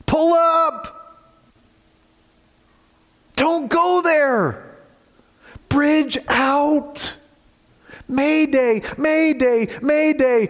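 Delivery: high urgent shouted warning; alarm call. Retold or imitated. Pastor voicing the letter writer's alarm to his readers, high urgent shouts. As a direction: cry out like a pilot calling mayday. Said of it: "And so he speaks like this".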